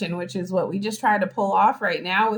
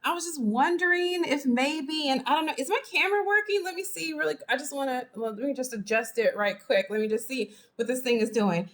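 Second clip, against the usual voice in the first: higher, softish fake-casual fussing; coy showing off; 5 semitones higher than usual. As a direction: feign innocence while angling to be noticed. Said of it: "you're like".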